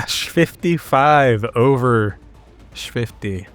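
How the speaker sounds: normal talking voice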